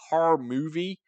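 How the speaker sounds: mock American accent. Correcting what Americans call films, mock American accent. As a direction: lean on the American R's. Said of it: "'cause you go movie".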